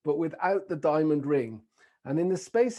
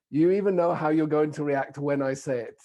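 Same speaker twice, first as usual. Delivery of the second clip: ordinary talk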